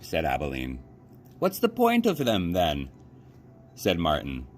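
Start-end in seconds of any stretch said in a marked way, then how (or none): 1.4-2.9 put-on high, scoffing boy's question; a boy sneering at a toy; scornful boy. A narrator's reading. Boy asks what the point is.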